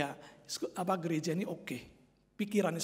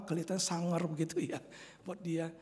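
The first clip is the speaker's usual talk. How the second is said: ordinary delivery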